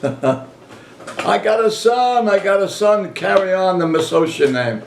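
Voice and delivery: joyful, slow high exclaim of pride; crowing about a new son; excited. Retold or imitated. Proud new father exclaiming.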